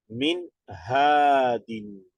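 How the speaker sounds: "Min hadin" drawn out slowly and the nun is not nasalized; slow, careful tajweed reading of "Min hadin"; Arabic recitation demo. Shown as a demonstration. Teacher reading the example to students, slow and careful. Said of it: "Entonces acentuamos nada más".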